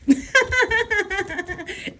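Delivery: a long high cackle of laughter; cracking up at her own joke. Real, not acted.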